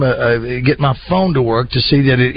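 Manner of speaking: usual speaking voice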